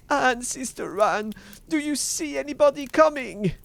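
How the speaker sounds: high, loud call for help; desperate cry. A narrator's reading. Wife calling to Sister Anne on the tower, desperate high cry. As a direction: cry out urgently to the lookout. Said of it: "and then she cried out".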